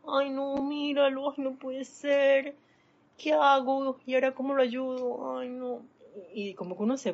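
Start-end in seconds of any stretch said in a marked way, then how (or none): 0.1-5.9 high-pitched hammed-up distress; distraught pity. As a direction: wring your hands over the poor thing. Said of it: "se te pega ese sentimiento".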